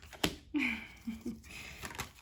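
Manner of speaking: soft, voiceless, airy little laugh; breathy laugh. Real, not acted. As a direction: let out a quiet breath of a laugh. Laughs while reading the cards.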